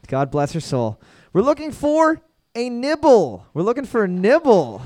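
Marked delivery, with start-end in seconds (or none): 1.3-3.4 slow, higher quiz-host announcement; drawn-out answer reveal. Acted. Quiz host revealing the answer to the room, slow and high. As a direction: stretch it out like a game show reveal.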